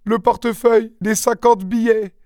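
très aigu, plaintif; désespéré. Acted. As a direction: avoue la perte, accablé. Mari désespéré. (mari accablé par le vol, voix aiguë)

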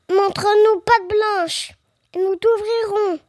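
put-on young kid calling out. A narrator's reading. Eldest kid to the wolf behind the door, calling out. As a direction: call out firmly as the eldest kid. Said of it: "cria très fort".

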